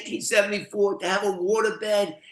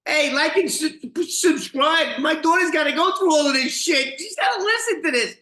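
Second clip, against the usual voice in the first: high, loud promo voice; hyped plug; 10 semitones higher, 5 dB louder than usual. Real, not acted. Dad plugs his channel to viewers, hammed up. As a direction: pitch the subscribe with a grin.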